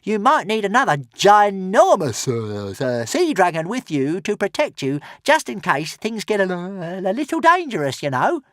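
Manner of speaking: high, offhand creature voice; small dragon offering help casually; careless little dragon. Acted. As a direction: toss it off casually. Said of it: "said Toothless carelessly".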